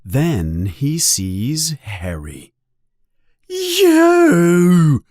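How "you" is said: very high, loud accusing screech. A narrator's reading. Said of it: "he screeches".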